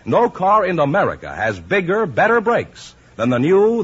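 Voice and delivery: ordinary talk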